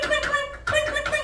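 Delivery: very high-pitched clink noises; ping out repeated tiny metallic taps; squeaky falsetto sound effect; imitated clinking. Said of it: "the turtle hears the clink".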